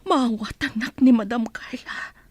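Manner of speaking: anxious whisper to herself; whispered worry. Acted. Mother alone after the quarrel, an anxious whisper. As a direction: breathe it out, afraid to be heard.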